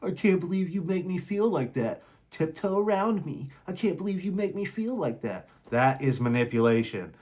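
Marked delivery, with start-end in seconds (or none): 0.0-5.5 higher, put-on whiny voice mocking her; lightly mocking the manipulative woman. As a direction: parody a guilt-tripping woman. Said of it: "Narcissistic people expect others to tiptoe".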